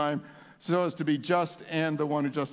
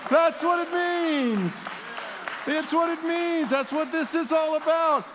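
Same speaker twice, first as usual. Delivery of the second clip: full-force preaching; 11 semitones higher than usual. Real, not acted. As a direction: preach it out with rising fire.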